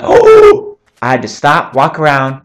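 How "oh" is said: high, loud gasping oh; surprised gasp. Retold or imitated. Youtuber retelling his view count, gasps loudly. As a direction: gasp like you just saw a huge number. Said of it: "I was so surprised".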